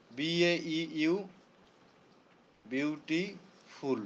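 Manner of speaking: "Beautiful" said slowly and quietly in separate syllables; broken into syllables. Shown as a demonstration. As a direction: draw out "beautiful" part by part. Then splits it into syllables.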